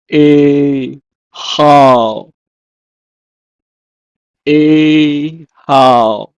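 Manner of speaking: ehal split into two long syllables; Hebrew pronunciation. Shown as a demonstration. Teacher gives the Hebrew for palace, drawn-out ehal. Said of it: "is pronounced as".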